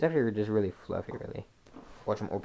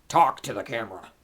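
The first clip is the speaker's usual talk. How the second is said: loud order at usual pitch; loud command; 10 dB louder than usual. Pretend. Vlogger ordering the cat to speak, loud command. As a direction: order it firmly and loudly.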